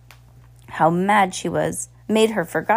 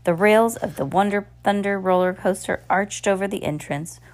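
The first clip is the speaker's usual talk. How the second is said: ordinary delivery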